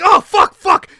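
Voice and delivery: high, loud cursing; panicked swearing. Retold or imitated. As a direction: swear in rising alarm. Spider escaping from bowl.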